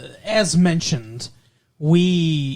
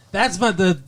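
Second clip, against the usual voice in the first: normal talking voice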